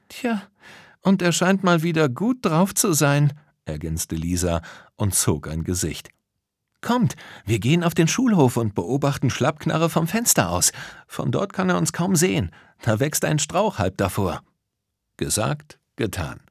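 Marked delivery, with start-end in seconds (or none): 0.1-3.3 Lisa's voice. A narrator's reading. Lisa voiced in story. 6.8-14.4 Lisa's voice. A narrator's reading. Lisa voiced, plans.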